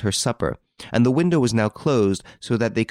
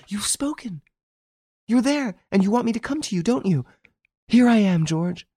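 high, eager, breathless-with-love outburst; excited cry; 12 semitones higher than usual. A narrator's reading. Girl thinking her lover has answered, excited cry. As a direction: cry it out high, rushing to him. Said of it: "cried the girl".

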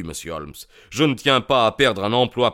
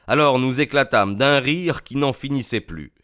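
usual speaking voice

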